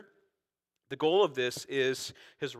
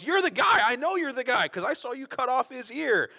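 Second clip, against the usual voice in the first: high, loud accusing voice; accusing relative; 10 semitones higher, 6 dB louder than usual. Acted. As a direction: point the finger, loud and high. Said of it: "he goes".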